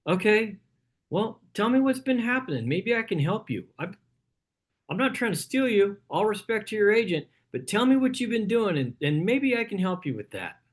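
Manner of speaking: helpful agent demo. Shown as a demonstration. Agent answering a struggling buyer, lightly friendly. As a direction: reassure the buyer, no pressure. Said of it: "Somebody says".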